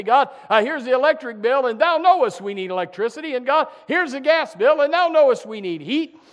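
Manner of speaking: a worried believer praying over bills. Retold or imitated. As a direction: list each bill to God with urgency. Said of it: "and you say".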